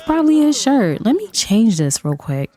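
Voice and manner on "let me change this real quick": ordinary talk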